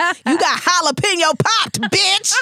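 high, hammed-up triumphant taunt; acted-out knockout boast, pitched high; mock trash talk. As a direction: crow the fantasy insult with a laugh behind it. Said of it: "she fantasizes about walking in the bank and just punching her".

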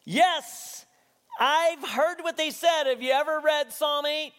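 high, loud retort; shouting back. Retold or imitated. Jesus answers the indignant religious leaders, shouting back. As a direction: fire it back at full volume, slowing mid-reply. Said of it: "no way he doesn't shout this back".

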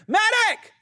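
high, loud desperate battlefield cry; medic shout. Shown as a demonstration. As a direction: yell for a medic across the field. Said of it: "just pretend you hear".

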